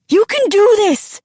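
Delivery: high, urgent shouted encouragement; calling back. A narrator's reading. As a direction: call across to your child. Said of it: "Sue's dad called back".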